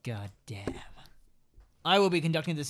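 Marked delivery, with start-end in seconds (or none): 0.0-0.7 whisper. Acted. Muttered reaction to insult joke.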